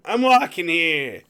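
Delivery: New York line. Retold or imitated. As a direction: say it like a New Yorker. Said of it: "typical New Yorker line".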